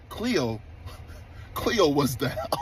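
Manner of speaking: real laugh swallowing the last word; cracking up. Real, not acted. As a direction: try not to laugh, then lose it. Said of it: "I promised I wasn't gonna laugh".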